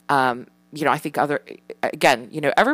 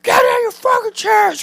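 high, loud strained rant; imitated angry yelling; 6 dB louder than usual. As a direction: re-enact a parent yelling at the kids. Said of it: "Why are you yelling".

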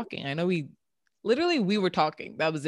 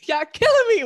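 words squeezed out through laughter; laughing hard; 11 dB louder than usual. Real, not acted. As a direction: crack up while talking. Laughing hard mid story.

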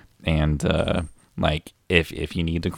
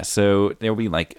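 ordinary delivery

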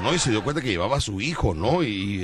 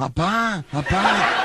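put-on higher childlike call with a laugh; imitating the son; 7 semitones higher, much slower than usual. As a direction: call out like a little boy. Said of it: "Y el niño".

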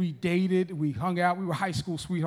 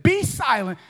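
raised, louder command to hush; firm command; 4 semitones higher, 5 dB louder than usual. Acted. Jesus silencing demons, the nice version, firm command. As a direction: order it firmly but civilly. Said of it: "that was the nice version".

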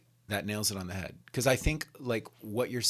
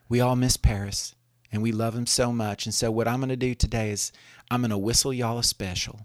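imitated Southern lady's accent, a bit louder; 2 semitones higher, 6 dB louder than usual. Care home lady announcing her funeral tribute, southern accent. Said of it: "she said it in her southern accent".

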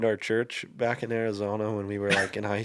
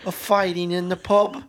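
high, put-on British pub-goer; jokey British pub voice; mock British pub accent; 6 semitones higher than usual. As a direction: do a hammed-up British accent, pitched up. Repeats pub line in put-on accent.

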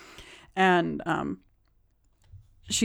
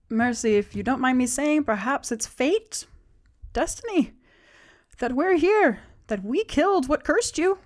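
gentle persuading companion; 6 semitones higher than usual. Acted. GM voices Olos persuading Mercy.

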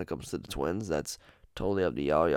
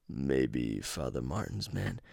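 slow, low, softer calm killer twin; 5 semitones lower, 6 dB quieter, slower than usual. Retold or imitated. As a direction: murmur it slow as the calmer twin. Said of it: "I'm trying to do their voices".

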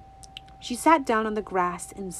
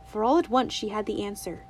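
ordinary delivery

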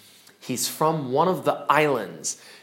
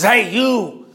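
rough, raspy stranger calling out; 4 semitones higher, 4 dB louder than usual. Acted. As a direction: bark it out in a raspy voice. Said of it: "with a raspy voice and says".

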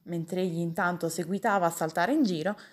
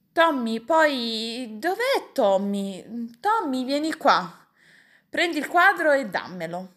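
put-on raised, higher voice summoning a child; impatient uncle shouting for help; calling out orders; 5 semitones higher, 4 dB louder, slower than usual. A narrator's reading. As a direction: call Tommy over, bossy and loud. Uncle calls Tommy, orders him.

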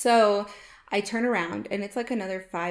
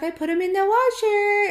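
put-on high childlike daughter voice; 11 semitones higher, 6 dB louder than usual. Daughter telling mom where her boots went, high childlike voice. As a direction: pitch up into a small child's reply. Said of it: "And she's like".

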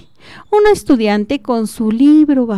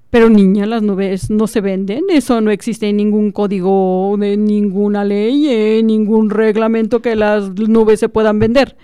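a hint of a pompous lawyer, lecturing; a touch of a self-important lawyer scolding; lightly voiced lawyer. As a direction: talk down to the child with mild authority.

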